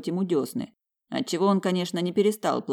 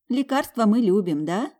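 put-on higher, cooing to a baby; 7 semitones higher, 4 dB louder than usual. A narrator's reading. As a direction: coo sweetly at the baby. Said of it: "проворковала она".

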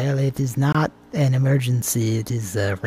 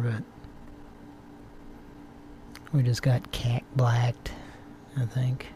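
hushed unvoiced whisper; 9 dB quieter than usual. Real, not acted. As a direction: whisper it under your breath. Whispered aside during prank call.

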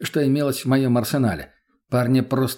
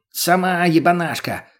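snapping back; 5 semitones higher, 3 dB louder than usual. Acted. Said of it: "огрызнулся тот".

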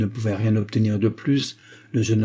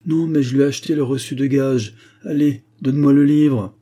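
louder, higher, impatient customer; raised-voice, rude insistence; impatient demand; 4 semitones higher, 6 dB louder than usual. A narrator's reading. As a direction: press for the book, voice raised.

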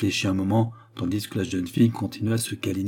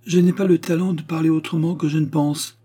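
higher-pitched, tart retort; sharp retort; 6 semitones higher, 4 dB louder than usual. A narrator's reading. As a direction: answer back sharply, pitched up. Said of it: "répliqua-t-elle vertement". (réplique verte, ton piqué)

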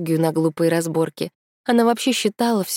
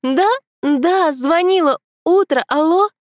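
high, louder stranger's voice on the phone; stranger mumbling into phone; 11 semitones higher, 5 dB louder, slower than usual. A narrator's reading. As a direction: babble into the receiver as a stranger. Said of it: "незнакомка всё бормотала в трубку".

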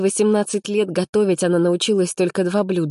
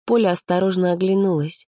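usual speaking voice

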